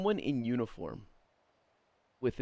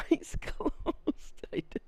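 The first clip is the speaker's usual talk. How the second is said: high squeal of laughter through the words; helpless laughter; 11 semitones higher than usual. Real, not acted. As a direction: barely get the words out for laughing. Cracking up at a pointless detail.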